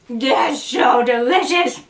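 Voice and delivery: slow, said through a mouthful; mouth full. Real, not acted. Tasting the roast on camera, mouth full. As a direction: talk around a bite of roast. Talking after taking a bite.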